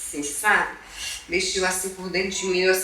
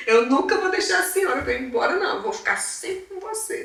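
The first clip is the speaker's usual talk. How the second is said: higher-pitched voice of a devoted son; imitating son's promise; 8 semitones higher than usual. Son's promise to mother, retold. (imitando a promessa do filho)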